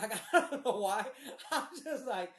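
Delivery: real amused laugh breaking into speech. Real, not acted. Storyteller cracking up at his own panic, laughing.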